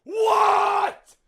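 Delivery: very high, loud scream; one long piercing yell; disbelieving shout. Real, not acted. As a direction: shriek in total disbelief. Outburst at losing.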